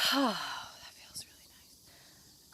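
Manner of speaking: slow, sighing whisper of relief; relieved sigh. Real, not acted. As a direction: breathe it out with a sigh. Relaxing in the sun.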